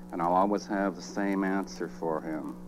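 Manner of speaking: usual speaking voice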